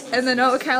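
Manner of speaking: usual speaking voice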